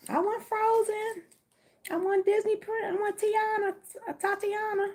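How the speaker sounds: put-on high child voice listing every toy; greedy kid pleading. Kid at the Easter egg hunt begging mommy, high whiny voice. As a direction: whine for each toy like a little kid.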